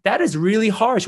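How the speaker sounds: can't believe he just said that; incredulous protest. Retold or imitated.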